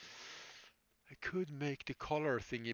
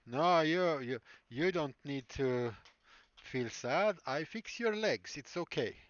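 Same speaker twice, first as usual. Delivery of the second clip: hammed-up Russian street-doctor voice, swooping higher; weird Russian accent; 3 semitones higher, 3 dB louder than usual. Creepy doctor reassures a self-conscious patient, weird Russian accent. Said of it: "he has a weird Russian accent".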